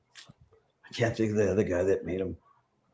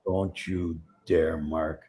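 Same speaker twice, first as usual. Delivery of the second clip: a slow, low, menacing warning; 5 semitones lower, 6 dB quieter, much slower than usual. Retold or imitated. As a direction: draw it out slowly, like a threat. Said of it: "and he goes".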